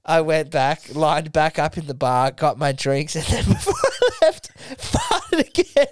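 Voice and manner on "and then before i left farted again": helpless laughter breaking up the words; laughing while talking. Real, not acted. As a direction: crack up at your own story. Cracking up at own story.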